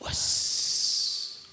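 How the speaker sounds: hissed whisper; whispered taunt. Acted. Poet echoes the word in his ears, whispered. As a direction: whisper it with a long buzzing hiss.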